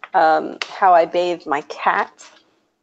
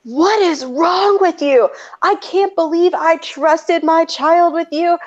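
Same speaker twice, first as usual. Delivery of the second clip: furious mock screaming; 10 semitones higher than usual. Furious mother confronting her child's teacher, mock screaming. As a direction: act out a livid mother without full yelling. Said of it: "like, screaming".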